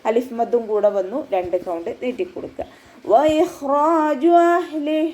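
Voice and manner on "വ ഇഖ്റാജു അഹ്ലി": slow, drawn-out, higher chanted recitation; Quran recitation. Shown as a demonstration. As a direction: recite it slowly with tajweed. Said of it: "വാവ് ഉച്ചരിക്കുമ്പോൾ".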